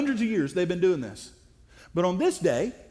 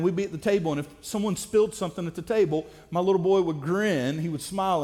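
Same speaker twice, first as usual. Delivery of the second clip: ordinary talk, nothing marked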